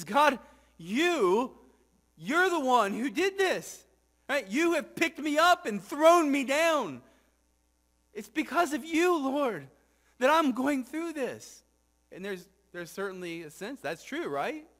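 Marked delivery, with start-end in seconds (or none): 0.0-11.6 higher, louder pleading accusation toward God; anguished psalmist. Acted. Psalmist blaming God for his suffering, raised anguished plea.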